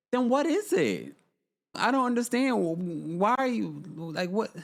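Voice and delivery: high, incredulous question; can't believe what she heard; baffled disbelief. Real, not acted. Baffled by the dating excuse.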